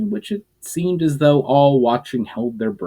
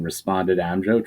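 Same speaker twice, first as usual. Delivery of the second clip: usual speaking voice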